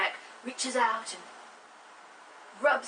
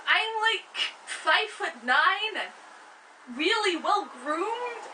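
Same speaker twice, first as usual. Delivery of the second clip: high, slow whining son; whiny protest; 7 semitones higher than usual. Acted. Jacob protests to his mother, high slow whine. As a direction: squeal out the protest like a fussy teenager.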